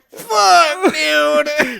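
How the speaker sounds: high, loud mock groaned curse; hammed-up pain after a crash; Robert Pattinson as Batman. Batman getting up after a fall, loudly cursing. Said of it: "Robert Pattinson gets up and is just like".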